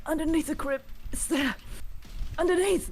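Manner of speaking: high, strained croak of a tortured woman. Acted. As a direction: force the words out, weak and desperate. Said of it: "barely able to croak out a voice".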